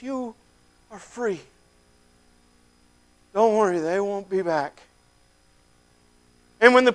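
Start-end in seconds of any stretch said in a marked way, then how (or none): 0.0-4.8 gentle reassurance. Acted. The stranger tells the freed man he is free, gentle and soft. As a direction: comfort him quietly.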